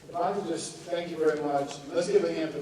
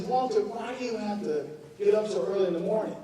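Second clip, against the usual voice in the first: scolding aunt; 6 semitones higher, 4 dB louder than usual. Retold or imitated.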